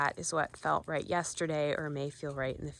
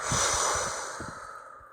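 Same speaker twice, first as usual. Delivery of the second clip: long breathy sigh out through the mouth; audible sigh. Shown as a demonstration. Guide demonstrating the exhale for viewers, audible sigh. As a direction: let a full exhale sigh out. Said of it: "sigh it out".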